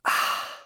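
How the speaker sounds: a soft, voiceless, satisfied "ah" after a drink; breathy ah. Acted. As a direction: breathe out a contented ahh after the milk. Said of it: "let out a breathy".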